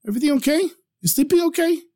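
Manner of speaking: high, light sing-out questions; innocent questioning. A narrator's reading. Young man innocently questions shocked merchant.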